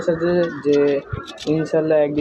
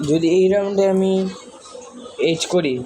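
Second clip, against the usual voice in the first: excited hype; 5 semitones higher than usual. Real, not acted. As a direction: build it up big for the audience. Hyping the round to viewers.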